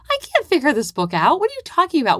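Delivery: put-on high, rising, bewildered kid voice; confused child. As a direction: play the lost, frustrated little reader. Child confused by a hard book.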